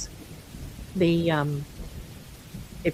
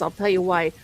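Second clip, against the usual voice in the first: usual speaking voice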